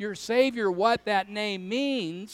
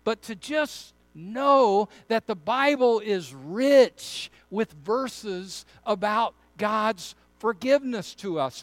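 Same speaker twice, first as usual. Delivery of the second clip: usual speaking voice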